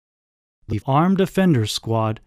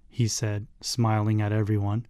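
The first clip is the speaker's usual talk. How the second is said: plain reading voice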